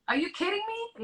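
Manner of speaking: hearing the tumor is aggressive, incredulous; shocked disbelief. Retold or imitated. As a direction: throw it up in stunned outrage.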